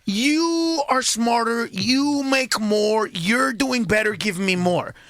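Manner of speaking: drawn-out slow plea for money; slow, coaxing America. Retold or imitated. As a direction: stretch it out, wheedling. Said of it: "America's already saying".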